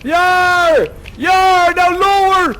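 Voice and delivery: high, loud shouted commands over the storm; ship's bosun yelling through the gale; bosun shouting orders. Acted. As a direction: bellow orders to the crew in a tempest. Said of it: "I'm gonna be the bosun".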